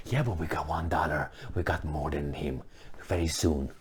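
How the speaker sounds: low, soft, quietly confident; mock deluded husband. A husband quietly insisting to his wife they'll be rich, low and soft. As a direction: murmur a foolish boast.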